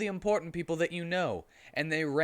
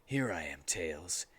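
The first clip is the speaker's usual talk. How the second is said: much lower, softer edgy voice; Shadow's voice; 8 semitones lower, 7 dB quieter than usual. A narrator's reading. Shadow answering Tails from the dark, low and soft. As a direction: go low and brooding. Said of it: "said Shadow".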